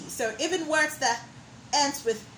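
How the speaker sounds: ordinary delivery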